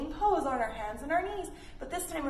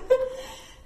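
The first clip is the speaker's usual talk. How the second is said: breathy laugh; 13 semitones higher, 6 dB louder than usual. Real, not acted. Laugh after roll.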